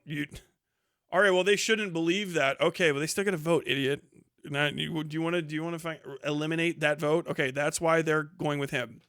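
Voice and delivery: normal talking voice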